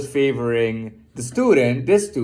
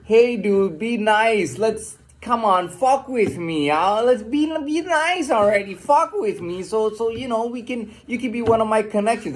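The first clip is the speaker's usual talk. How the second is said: mock cop pleading; 8 semitones higher than usual. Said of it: "it is not done on the basis that".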